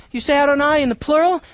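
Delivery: normal talking voice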